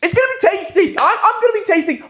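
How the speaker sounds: high-pitched excited repetition; playful hype. Pretend. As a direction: whip the viewers up, voice pitched high. Hypes tomorrow's stream.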